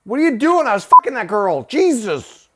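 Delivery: high, loud mock outrage; hammed-up indignant shouting; outraged yell. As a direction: yell like a furious interrupted lover. Acts out the interrupted lover.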